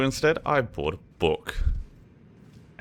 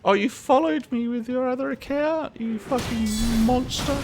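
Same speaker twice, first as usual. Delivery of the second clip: mock outrage. Teasing a chatter.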